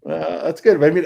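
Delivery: usual speaking voice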